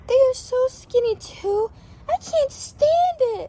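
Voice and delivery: high, loud wail of pity; high pitched anguished sheep; distressed outcry. Acted. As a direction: cry out that you can't bear it. Sheep upset about neighbors.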